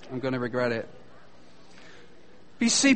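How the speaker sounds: usual speaking voice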